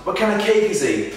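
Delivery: high, projected call to the crowd; addressing the crowd. Retold or imitated. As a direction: re-enact rousing a wrestling audience. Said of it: "everyone in the crowd".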